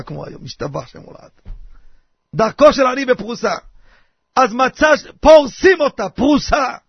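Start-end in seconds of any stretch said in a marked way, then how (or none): none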